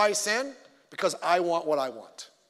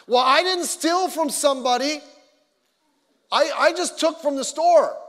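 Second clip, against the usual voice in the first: higher and louder, a sarcastic looter's whine; playing the self-justifying thief, higher and louder; mock looter excuse; 7 semitones higher, 6 dB louder than usual. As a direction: sneer it out as the looter making excuses. Mocking an excuse-making looter.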